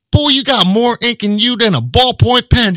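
loud, high drill sergeant bark; drill sergeant shout. Retold or imitated. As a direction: roast him like a drill sergeant. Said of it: "I'm like".

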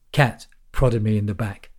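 ordinary delivery

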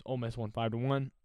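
normal talking voice

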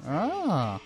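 ordinary delivery

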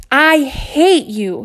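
high, loud, slow shout; a boy shouting at his teacher before running out. Acted. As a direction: yell it slowly, full of hurt. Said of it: "he shouted".

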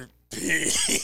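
low, soft, drawn-out ominous voice; Undertaker. Retold or imitated. Answering about Undertaker, low drawn-out eerie impression. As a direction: draw it out low like a dark wrestler. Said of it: "what about Undertaker".

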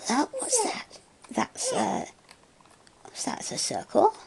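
high, delighted sing-song. Real, not acted. Talking to the baby.

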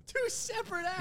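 laughing echo. Real, not acted.

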